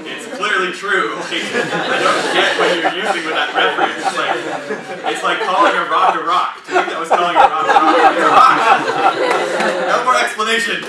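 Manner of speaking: can't stop laughing as he explains; high, loud laughing rant; laughing loudly. Real, not acted. Laughing retelling of his reply.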